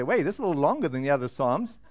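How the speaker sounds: quick, low-key aside in a reader's voice; puzzled reader. Acted. Bible-plan reader hitting Psalm hundred nineteen, lightly acted puzzlement. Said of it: "You say".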